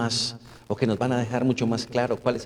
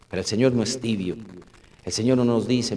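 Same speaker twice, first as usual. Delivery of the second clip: normal talking voice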